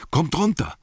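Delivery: high, urgent call with falling ends. A narrator's reading. Bilbo calling to the dwarves still on the branch, high urgent shout. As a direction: call out high to the others, repeating it harder. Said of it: "rief er den Zwergen zu".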